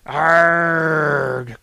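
loud growled shout. A narrator's reading. Prometheus growls amid the party chaos. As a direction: let out a rough growl. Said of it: "growled Prometheus".

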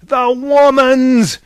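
very loud, high, drawn-out bellow; furious god bellowing; thunderous roar. A narrator's reading. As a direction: roar it out, holding the words. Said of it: "roared Zeus".

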